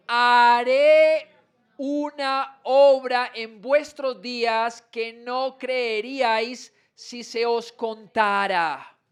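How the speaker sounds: slow, stretched reading with a strong opening word; solemn public Bible reading; scripture proclamation. Acted. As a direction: proclaim each command slowly. Reads God's words aloud.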